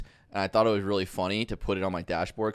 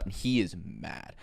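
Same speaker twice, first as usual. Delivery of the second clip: ordinary talk, nothing marked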